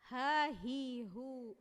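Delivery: "ha, hi, hu" breathy flowing h; ha demo. Shown as a demonstration. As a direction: breathe through each h. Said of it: "mengalir lembut pengucapan".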